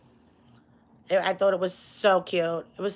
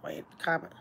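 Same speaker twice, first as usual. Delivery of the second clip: whisper; 3 semitones lower, 10 dB quieter, faster than usual. Real, not acted. Reading chat aside to herself.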